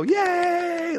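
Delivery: high, loud delighted cheer; cheering yay. Shown as a demonstration. Parents cheering their daughter's moo, high excited yay. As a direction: cheer like praising a toddler. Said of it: "like this".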